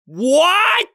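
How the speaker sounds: high, loud, startled yelp; shocked outcry. Acted. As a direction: blurt it out in disbelief. Startled by sudden marriage proposal.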